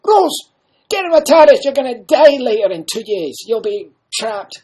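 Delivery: hammed-up breezy welcome; the Doctor hailing Rose, loud and high; loud cheery pitch. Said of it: "I say to her".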